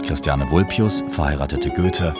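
ordinary talk, nothing marked

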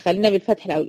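ordinary talk, nothing marked

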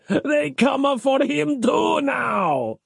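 high, drawn-out lament; wailing. A narrator's reading. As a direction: keep wailing, high and slow. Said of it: "wailed Giuseppe".

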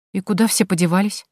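ordinary delivery, nothing marked

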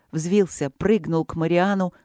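usual speaking voice

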